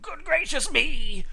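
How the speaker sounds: high, loud, drawn-out shock; startled mother dinosaur; exclaiming. A narrator's reading. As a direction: exclaim it in alarm.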